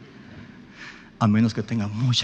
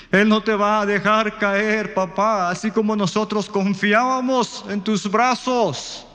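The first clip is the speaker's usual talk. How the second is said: slow, high, louder impassioned plea building higher; fervent pleading; 9 semitones higher, 3 dB louder, slower than usual. Retold or imitated. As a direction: beg him to let go and trust, rising in fervor. Daughter pleading faith to sick father.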